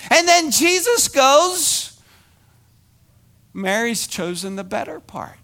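fired-up pulpit delivery; full-force preaching. Acted. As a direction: preach it hard to the crowd.

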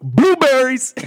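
high, loud pundit outburst; sports pundit's over-the-top exclamation; Stephen A. Smith. Acted. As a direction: holler it out high, hammed up. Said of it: "Just all that type of".

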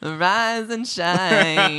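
very slow, drawn-out, high British wake-up call; singsong fake British, long vowels; mock British accent. Shown as a demonstration. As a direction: stretch each word in a hammed-up British accent. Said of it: "Do British".

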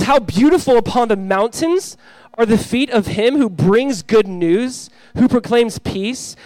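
high, loud, slow proclamation; declaimed scripture. Real, not acted. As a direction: ring out each phrase like a herald, drawn out.

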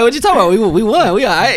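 high, loud incredulous retort; acting out an excited comeback; animated protest. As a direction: protest loudly, pitched well up. Acts out his upbeat protest to her.

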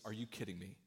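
low, soft disbelieving groan; exasperated groan. Acted. Woman at the gate seeing her ex-husband, low exasperated groan. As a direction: mutter it as the woman spotting her ex.

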